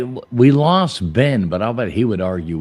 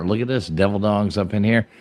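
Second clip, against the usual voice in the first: ordinary talk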